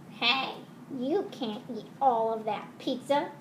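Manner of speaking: usual speaking voice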